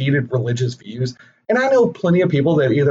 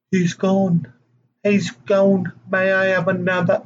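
[name]; 5 semitones higher, slower than usual. Acted. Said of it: "a good [name] moment".

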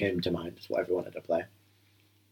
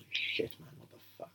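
whispering; 14 dB quieter than usual. Real, not acted. Shocked aside during film.

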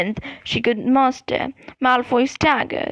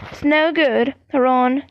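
ordinary delivery, nothing marked

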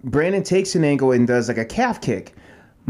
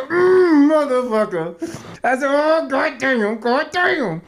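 pained groaning; 11 semitones higher, much slower than usual. Retold or imitated. Retelling lying hurt on mat.

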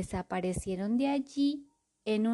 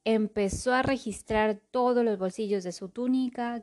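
ordinary delivery

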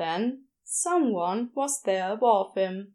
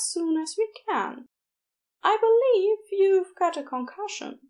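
kindly nurse; 6 semitones higher than usual. A narrator's reading. Nurse Aziraphale greeting injured Crowley, cheerful and soothing. Nurse figure voiced in story.

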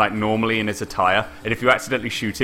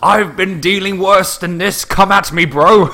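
loud, high, slow taunt; exaggerated fighting bravado; mock challenge; 9 semitones higher, 6 dB louder, slower than usual. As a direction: bellow it like a hammed-up tough butler. Acting out Winston challenging the player.

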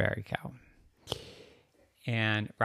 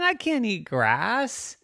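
put-on high, drawn-out kid protest; high-pitched childish dismay; dismayed girl; 11 semitones higher, 5 dB louder, slower than usual. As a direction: object in a high, slow, loud voice. Girl rejects grass.

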